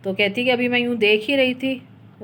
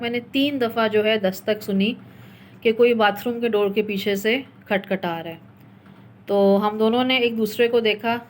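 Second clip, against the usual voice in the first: ordinary talk, nothing marked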